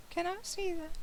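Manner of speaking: small, high, soft pleading voice; hushed, high little request; coaxing wife. Acted. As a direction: ask softly and high, angling for a treat. Melissa eagerly asks for the box.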